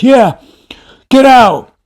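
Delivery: very high, loud mock shout; high, strained send-off yell; triumphant angry yell. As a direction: imitate a furious man proud of chasing someone off. Angry man yells after him.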